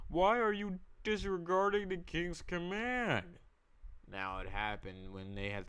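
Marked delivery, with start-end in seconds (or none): 0.1-3.4 high-pitched mock servant voice; questioning servants. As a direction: put on a high voice to challenge him. Voicing servants questioning Mordecai.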